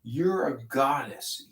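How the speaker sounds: ordinary talk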